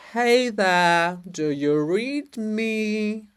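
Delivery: loud hail that slows on the question; calling out. Acted. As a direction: call out across a distance, drawing the question out. Calling out to distant agent.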